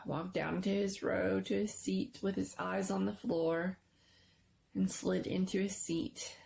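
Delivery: usual speaking voice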